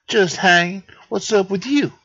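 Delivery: high squirrel voice; squirrel character. Acted. Squirrel chatting with the old detective, high voice. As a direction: toss it back in a high voice.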